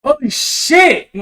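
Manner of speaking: high, slow exclamation of disbelief; surprised. Real, not acted. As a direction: gasp it out in disbelief. Reacts to the eagle.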